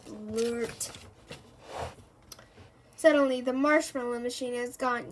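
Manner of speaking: plain reading voice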